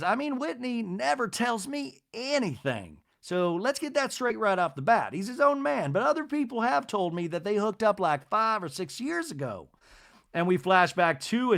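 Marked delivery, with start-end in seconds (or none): 0.0-9.7 high-pitched society matron impression; Miss Patricia. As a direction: imitate a lady gossiping in a high, lilting voice. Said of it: "Miss Patricia says".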